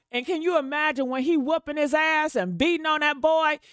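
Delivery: usual speaking voice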